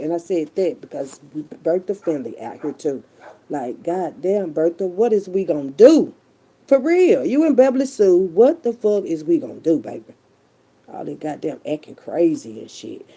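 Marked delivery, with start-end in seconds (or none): 4.5-9.8 calling out. Real, not acted. Calling out to Bertha.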